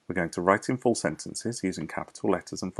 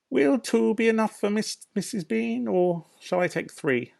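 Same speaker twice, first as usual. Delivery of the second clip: higher, louder call up the stairs; calling upstairs; 5 dB louder than usual. Acted. Maid calling up to her mistress, higher and louder.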